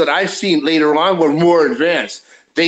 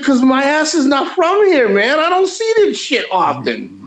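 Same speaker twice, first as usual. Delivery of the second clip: exasperated; 8 semitones higher than usual. Retold or imitated. Defending his cluelessness.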